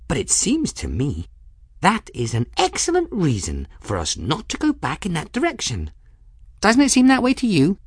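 put-on high-pitched girl, pleading her case; anxious Folly, high voice. Folly urging her jar against going back, high and anxious.

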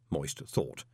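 ordinary delivery, nothing marked

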